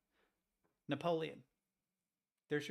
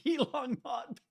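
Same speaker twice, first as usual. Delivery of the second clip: high laughing; 10 semitones higher, slower than usual. Real, not acted. Cracking up at Musk answer.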